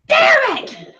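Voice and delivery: high, sharp yell of frustration; frustrated scream. Acted. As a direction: shout it out at the lost chance. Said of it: "I'm just gonna scream".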